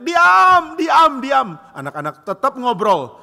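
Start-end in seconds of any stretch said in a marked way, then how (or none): 0.0-1.5 high, loud shout for silence; teacher shouting. Retold or imitated. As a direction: yell over the noisy class. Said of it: "ibu guru sudah teriak".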